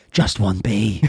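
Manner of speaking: low gruff action-film line; deadpan low put-on reveal; movie-twist hero. Hero at the film's twist ending, low voice. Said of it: "Here's the twist at the end".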